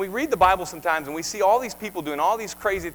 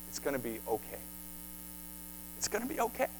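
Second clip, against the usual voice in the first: much softer, gentle comfort; soft reassurance; 4 semitones lower, 13 dB quieter, faster than usual. Acted. Preacher comforting a fearful church, soft and gentle. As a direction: soothe them quietly.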